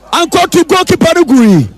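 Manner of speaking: ordinary delivery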